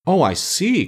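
high-pitched, relieved realisation; greatly reassured. A narrator's reading. Scarecrow hearing the servant's explanation, reassured. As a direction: let the pitch leap up in relief. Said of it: "greatly reassured".

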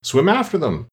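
high, restrained character yell; mild scream. A narrator's reading. Gryphon joining the dance excitedly, mild scream. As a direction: call out high without full volume. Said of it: "screamed the Gryphon".

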